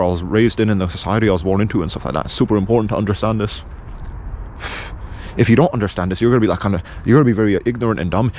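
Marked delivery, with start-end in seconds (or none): 3.7-5.3 a long, weary unvoiced sigh. Real, not acted. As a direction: let out a heavy breath. Long sigh mid rant.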